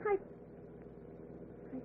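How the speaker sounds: slow breathless whisper; startled, barely get it out; frightened whisper. Acted. Lola, startled by the hitchhiker's touch, whispers in fright.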